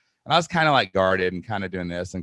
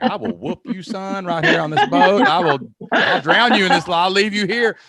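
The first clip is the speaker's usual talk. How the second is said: louder, higher mock threat; playful tough-guy threat; 10 semitones higher, 8 dB louder than usual. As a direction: bluster like a riled-up bro. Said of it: "And I'm like".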